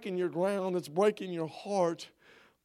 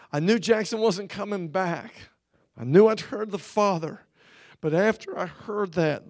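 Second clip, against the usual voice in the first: usual speaking voice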